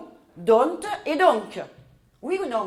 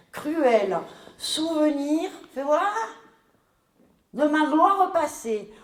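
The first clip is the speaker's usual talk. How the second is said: lecture lente; 4 semitones higher, slower than usual. Acted. Relit la réplique lentement.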